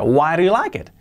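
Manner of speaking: teacher drilling linked why do. Shown as a demonstration. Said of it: "prácticamente una R suave".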